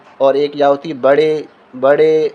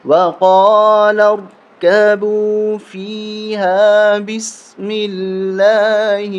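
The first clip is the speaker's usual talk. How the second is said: slow, higher melodic tajweed chant; Quranic recitation; 5 semitones higher, much slower than usual. Shown as a demonstration. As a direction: chant the verse with long drawn vowels. Recites the verse.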